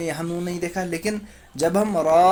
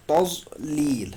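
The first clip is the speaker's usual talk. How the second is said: تضلیل drawn out slowly, each sound held; slow tazlil; much slower than usual. Shown as a demonstration. Teacher slowly models تضلیل for pupils. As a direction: stretch out تضلیل syllable by syllable. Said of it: "کیا پڑھیں گے؟".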